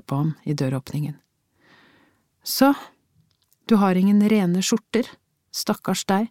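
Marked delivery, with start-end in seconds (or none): 2.4-6.3 higher, scornful pity; wife taunting husband about his shirts; mocking undertone. A narrator's reading. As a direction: rub it in with mock sympathy. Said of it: "Det var en hånlig undertone i stemmen hennes".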